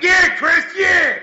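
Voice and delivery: high, loud yell into the phone; loud high shout of frustration. Retold or imitated. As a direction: shout his name at him. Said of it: "why are you yelling at me".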